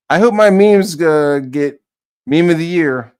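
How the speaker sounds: high, loud mocking voice; mocking high boast. Mocking Ethan hoping his memes win, high and loud. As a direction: squeal the brag high and loud.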